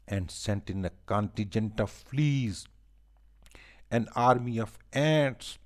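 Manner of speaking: animated, measured verse reading with punched rhymes; lively storytelling in rhyme; poem read to children. A narrator's reading. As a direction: recite it line by line for a class of children.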